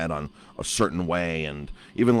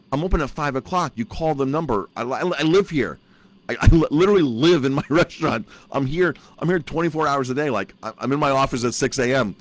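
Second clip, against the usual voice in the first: higher, louder indignant rant; exasperated rant; 7 semitones higher, 4 dB louder than usual. Retold or imitated. Owner tells the village manager she is always there, exasperated. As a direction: vent that you're always here.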